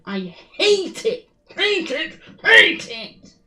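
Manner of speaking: from a slow grumble up to a loud, high shout; building frustrated yell. Real, not acted. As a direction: let the hate boil over with each repeat. Raging at the tracking system.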